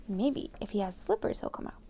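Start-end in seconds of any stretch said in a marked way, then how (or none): none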